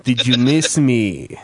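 slow, creepy serial killer voice; drawn-out menacing killer line; calm psychopath. Retold or imitated. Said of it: "With that fucking serial killer voice".

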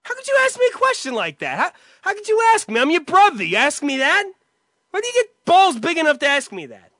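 high, loud, indignant yelling; acted outraged shouting. Accused brother shouts back in outrage. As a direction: hurl the indignation back at him. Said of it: "End scene".

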